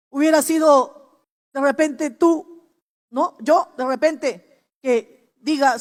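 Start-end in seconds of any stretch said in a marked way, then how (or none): none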